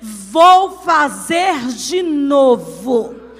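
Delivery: loud, long-held words of a fiery Pentecostal proclamation; preach at full force. Real, not acted. Preacher declaring God will do it for the congregation, full-force preaching. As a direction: proclaim the promise with building power.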